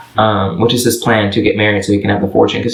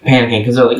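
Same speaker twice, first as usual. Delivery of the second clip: ordinary talk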